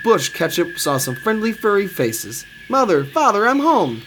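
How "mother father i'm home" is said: high, excited homecoming call. A narrator's reading. Ketchup thinks he sees his family, calling out. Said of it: "called Ketchup".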